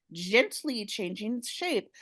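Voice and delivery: normal talking voice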